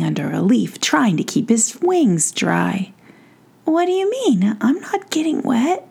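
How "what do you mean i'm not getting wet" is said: higher, haughty puzzlement; lightly lofty prince, unbothered; puzzled prince. Acted. Prince to Giroux, mildly haughty. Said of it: "said the prince".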